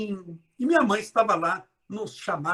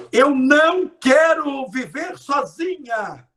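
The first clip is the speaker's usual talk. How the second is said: louder and higher, slow, stress on não; loud slow plea; 6 semitones higher, 7 dB louder, slower than usual. Shown as a demonstration. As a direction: declare it loud and drawn out. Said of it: "Minha filha, você tá falando de solidão". (alto, lento, ênfase no não)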